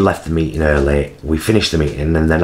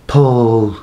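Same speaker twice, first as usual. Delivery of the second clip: raised West Midlands call; West Midlands accent; 6 semitones higher, 4 dB louder than usual. Retold or imitated. CEO's sister running in, imitated West Midlands accent. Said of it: "she's West Midlands".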